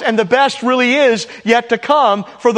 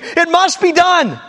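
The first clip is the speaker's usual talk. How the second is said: higher, mock scream of a dying woman; retold piercing scream; 7 semitones higher than usual. Preacher reading a deathbed account, a mock piercing scream. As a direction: lift the voice into a half-voiced shriek. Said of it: "end with a piercing scream".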